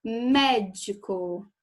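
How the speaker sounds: "Médico" slow, MÉ stretched out; stress on the first syllable. Shown as a demonstration. Teacher showing a proparoxytone, MÉ drawn out in "Médico". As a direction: punch and hold the "Mé" of "Médico". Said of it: "é o mé".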